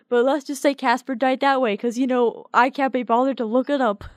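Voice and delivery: normal talking voice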